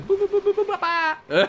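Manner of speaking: high squeaky boop boop noises, popping raisins; silly high beeps mimicking someone gobbling raisins; mock eating noises. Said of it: "imagine you just fucking going like this".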